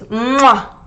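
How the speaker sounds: smacking kiss sound; blown kiss. Shown as a demonstration. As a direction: blow a kiss with squeezed lips. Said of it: "try to blow a kiss".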